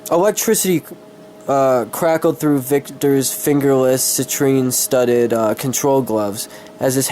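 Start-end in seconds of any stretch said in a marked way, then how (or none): none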